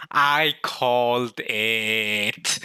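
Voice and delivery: very slow, drawn-out crowing; triumphant celebrating. Real, not acted. Fan relishing a correct guess, drawn-out triumph. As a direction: stretch every word in triumph.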